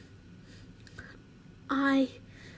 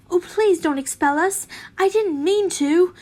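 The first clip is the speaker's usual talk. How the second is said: loud, high pleading; 6 semitones higher, 6 dB louder than usual. Acted. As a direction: beg her not to expel you. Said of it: "Ted pleaded".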